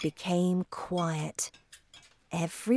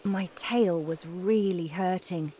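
ordinary delivery, nothing marked

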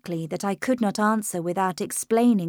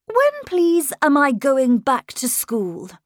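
put-on high, louder boyish voice, polite; 6 semitones higher, 5 dB louder than usual. A narrator's reading. Schoolboy politely asking his governess about school. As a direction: ask sweetly like a charming young gentleman. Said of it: "He sounded as polite and charming".

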